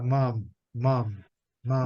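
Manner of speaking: mom with the lips fully shut on the m; lips closed on M. Shown as a demonstration. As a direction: close your mouth on each m of mom. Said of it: "o M tem que, tem que fechar a boca".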